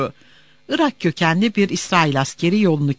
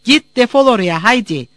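raised, loud, rough dismissal; shouted order; 5 semitones higher, 6 dB louder than usual. Acted. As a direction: chase them off, no longer polite. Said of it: "Asker bağırdı. Şimdi hiç de nazik değildi". (bağırarak kovma)

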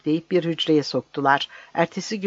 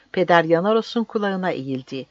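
ordinary delivery, nothing marked

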